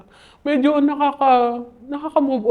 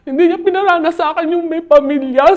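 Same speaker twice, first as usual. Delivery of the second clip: retold loud complaint; 6 semitones higher, 7 dB louder than usual. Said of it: "sabi niya".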